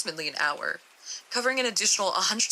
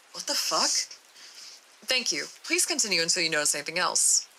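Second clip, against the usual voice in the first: ordinary talk, nothing marked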